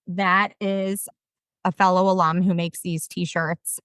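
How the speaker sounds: ordinary talk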